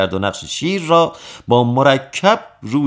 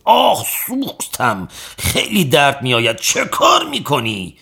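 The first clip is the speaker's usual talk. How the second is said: crying out in pain; 4 semitones higher than usual. A narrator's reading. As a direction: whimper and wail as if burning. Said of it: "باز فریادش بلند شد".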